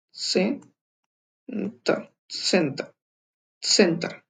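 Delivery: सेंटर stretched very slowly, then said quick; model pronunciation. Shown as a demonstration. Teacher slows सेंटर down for learners. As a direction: drawl out सेंटर, then clip it. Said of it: "इस तरह से pronounce होगा".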